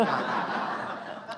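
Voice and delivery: breathy laugh bursting out; a short laugh between words; real laugh. Real, not acted. Laugh mid-story.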